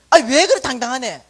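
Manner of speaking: loud, taken-aback cry; startled exclamation. Retold or imitated. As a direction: sound shocked and loud. Manager startled by boldness. (당당한 사장님에게 놀라 크게)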